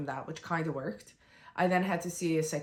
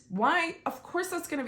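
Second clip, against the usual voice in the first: incredulous; 10 semitones higher than usual. Real, not acted. Incredulous at being taped, intubated.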